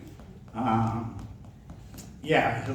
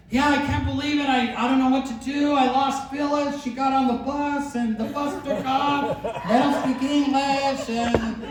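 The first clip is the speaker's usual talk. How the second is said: mock panicked wife; 12 semitones higher than usual.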